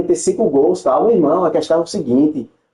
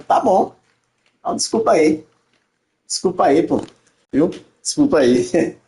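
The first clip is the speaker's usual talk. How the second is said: ordinary talk, nothing marked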